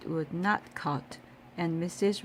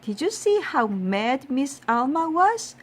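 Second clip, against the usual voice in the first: put-on boy's question; 7 semitones higher, 6 dB louder than usual. A narrator's reading. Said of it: "asked Arkalu".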